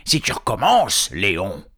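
angry troll. Acted. Hubert warning Léon, loud irritation. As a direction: scold a fellow troll loudly. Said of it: "trois trolls en colère".

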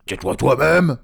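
loud, high comeback; angry troll retort. Acted. Tom answering Léon back, loud anger. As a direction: throw it straight back, loudly. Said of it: "trois trolls en colère".

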